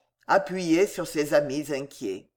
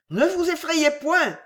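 high, raised call from some steps away; pitched-up shouted reassurance; 10 semitones higher, 3 dB louder than usual. A narrator's reading. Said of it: "répliqua monsieur Wardle quelques pas plus loin".

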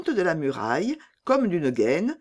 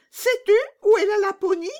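much higher, loud and blunt; bold robber girl; 5 dB louder, faster than usual. Acted. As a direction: toss it off like a fearless bandit girl. Robber girl asks reindeer.

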